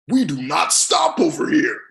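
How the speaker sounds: loud, punchy declaration, hitting stop; shout. Real, not acted. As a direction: shout it like a rallying cry. Fired-up motivational declaration.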